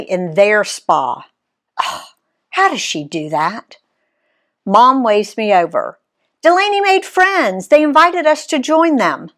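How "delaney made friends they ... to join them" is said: a mom's line voiced much higher. A narrator's reading. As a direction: lift the pitch high for Mom, lightly voiced.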